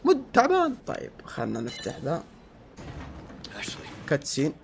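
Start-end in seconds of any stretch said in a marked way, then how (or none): 0.0-0.7 high, whiny imitation of a complaining viewer; mock whiny viewer. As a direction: play the annoyed fan griping. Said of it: "تكلمني تقول".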